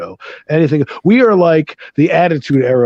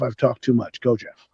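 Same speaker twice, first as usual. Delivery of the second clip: ordinary talk, nothing marked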